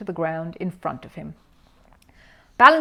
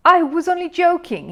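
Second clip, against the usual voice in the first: much higher, louder, smiling backpedal; forced smile; 11 semitones higher, 9 dB louder than usual. A narrator's reading. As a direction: cover the anger with a fake grin. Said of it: "creasing his face into a smile".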